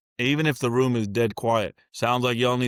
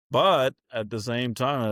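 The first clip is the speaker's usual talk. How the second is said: normal talking voice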